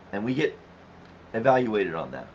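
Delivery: ordinary talk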